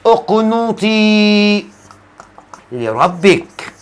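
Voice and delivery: drawn-out, measured recitation; slow verse recitation. Shown as a demonstration. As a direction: stretch the final vowel of اقنطي, then pause. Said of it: "لما ربنا بيقول لستنا مريم".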